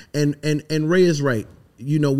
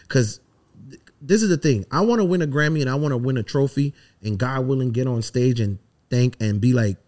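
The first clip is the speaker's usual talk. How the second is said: normal talking voice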